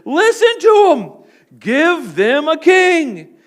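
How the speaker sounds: slow, high and loud, stretched out; voice of God. Retold or imitated. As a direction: speak as God, slowly and heavily. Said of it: "and the Lord answered".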